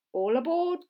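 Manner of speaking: loud, high urgent call; cried out. Acted. Professor hurrying the children onto the boat, calling out. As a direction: call everyone aboard in a hurry. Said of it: "cried the professor".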